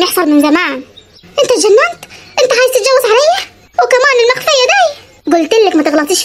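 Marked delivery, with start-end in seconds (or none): 1.4-5.0 higher and higher angry questions; betrayed wife confronting husband; rising outrage. Acted. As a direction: build the fury line by line.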